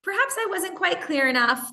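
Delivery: hammed-up sweetness hiding annoyance; sugary polite. Shown as a demonstration. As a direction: sweeten it to mask the irritation. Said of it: "Another more indirect way would go".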